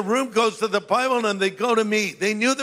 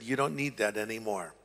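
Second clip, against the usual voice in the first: quiet voice of God; 9 semitones lower, 10 dB quieter than usual. Retold or imitated. Voice of God quoted.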